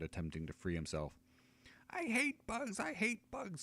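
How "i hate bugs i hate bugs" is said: high, shrill-pitched scream of a small halfling; high halfling screaming in panic; halfling's furious scream. Acted. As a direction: shriek it high and desperate. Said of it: "screams the halfling".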